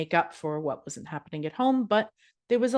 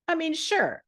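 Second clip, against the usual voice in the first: mock concession; 11 semitones higher than usual. Real, not acted. Mock concession, jumping pitch.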